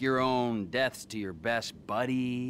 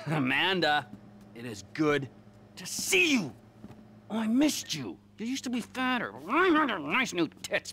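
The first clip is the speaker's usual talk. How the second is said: much higher and louder, fake delighted; mock gushing greeting; 8 semitones higher, 4 dB louder than usual. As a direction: gush over her, pure mockery. [name] mockingly greets the family.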